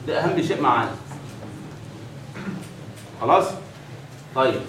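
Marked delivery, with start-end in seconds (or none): none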